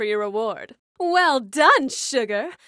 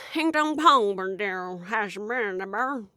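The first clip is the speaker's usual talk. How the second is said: imitating Jimbo Hodunk. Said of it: "He said".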